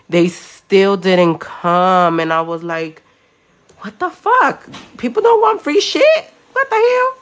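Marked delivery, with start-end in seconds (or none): 3.8-7.1 loud, high-pitched disbelief; squeaky, exasperated retold rant; incredulous outburst. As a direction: act out baffled outrage, voice shooting up. Retold disbelief at refused freebies.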